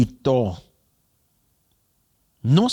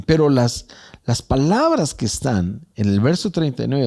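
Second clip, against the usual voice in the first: ordinary talk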